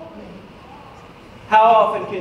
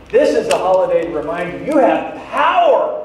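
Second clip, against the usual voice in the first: more aggressive. Shown as a demonstration. Preacher reminding the church they have power, aggressively. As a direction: drive it at them, punching the last word. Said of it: "put more aggressively for a moment".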